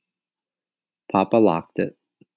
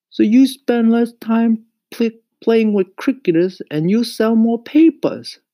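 high, loud scolding mother; scolding mama; 8 dB louder than usual. A narrator's reading. Mama tells Mario off over crickets, scolding. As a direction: nag at a high pitch, emphatically.

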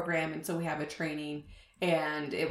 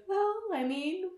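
coy high retort; 12 semitones higher than usual. Retold or imitated. Coy reconsideration, higher voice.

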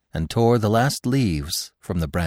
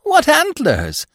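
very high, loud squeal of admiration; admiring cry; 7 dB louder, slower than usual. A narrator's reading. Squirrel praising Bambi's antlers, high loud squeal. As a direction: burst out in awe. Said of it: "he cried admiringly".

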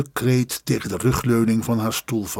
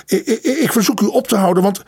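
breathless stammer; 8 semitones higher, faster than usual. Acted. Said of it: "begon hij buiten adem".

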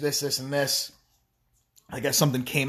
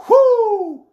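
loud high whoop of glee; exuberant whoop; 13 dB louder than usual. Real, not acted. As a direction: whoop it out in triumph. Celebrating Depp suing her.

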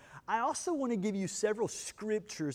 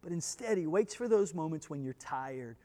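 ordinary talk, nothing marked

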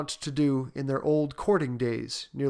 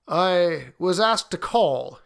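hesitant visitor; 4 semitones higher, 4 dB louder, slower than usual. A narrator's reading. Visitor's hesitant opening words.

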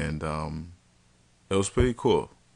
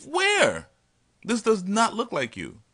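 high, loud disbelief; where, in total shock; man comparing his date to her photo; incredulous; 4 dB louder, slower than usual. Retold or imitated.

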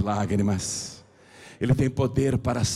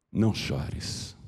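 much lower, softer and slow; tender comfort; 9 semitones lower, 5 dB quieter, slower than usual. Acted. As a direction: soothe her gently like a grieving mother. Jesus consoling the widow. (consolo terno e baixo)

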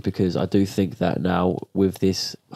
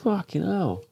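shocked; 10 semitones higher, slower than usual. Real, not acted. Shocked how long ago.

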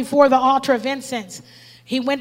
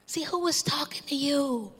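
whisper; 10 dB quieter than usual. Real, not acted. Preacher drops to a whisper.

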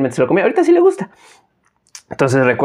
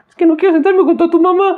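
put-on much higher whiny kid voice, laughing; imitating crying little brother. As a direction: whine it like a tearful little boy.

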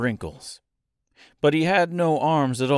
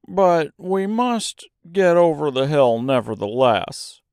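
higher-pitched, determined Scarecrow; Scarecrow's voice; 4 semitones higher, 4 dB louder than usual. Acted. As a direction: press on in a raised Scarecrow voice. Scarecrow figure speaks in story.

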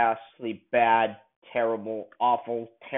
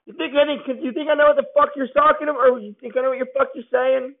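high, fast mocking imitation of McClane's line; 9 semitones higher, faster than usual. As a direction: parody the hero's clumsy line, sped up. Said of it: "and he says".

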